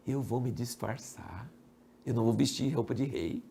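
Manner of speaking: hushed, low imitation of a plotter; low, soft acted scheming; quiet scheming. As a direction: confide a sly plan very softly. King quietly plots his disguise.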